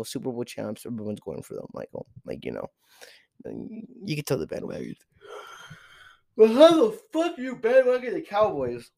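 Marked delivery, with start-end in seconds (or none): 6.3-8.9 high, loud outburst of disbelief; worked-up, high-pitched shout; incredulous yell. Acted. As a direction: yell it in exasperation. Acted outrage at bandwagon fans.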